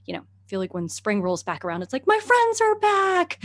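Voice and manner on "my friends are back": high, slow delighted cry; squealing with delight; excited greeting. Retold or imitated. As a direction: greet old friends with joy. Greeting plants in spring.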